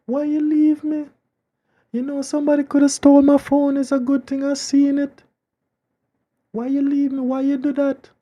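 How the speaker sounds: put-on high, loud whiny girlfriend voice. Girl on phone voiced in story.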